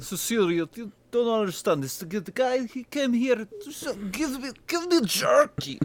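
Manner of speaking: put-on high, slowing Polish-accented voice of a painter; terrible Polish accent. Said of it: "That's a terrible Polish accent".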